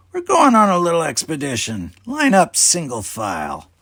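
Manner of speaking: booming command slowing into instructions; commanding captain. A narrator's reading. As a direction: order the crew about. Talos commands the crew.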